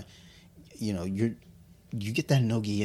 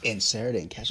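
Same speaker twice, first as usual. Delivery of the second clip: normal talking voice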